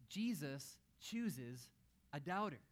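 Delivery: very slow, weighty "Jesus chooses"; slow emphasis. Acted. Preacher stressing that Jesus chose Thomas, slow and weighty. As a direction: let each word land. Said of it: "preacher's key point".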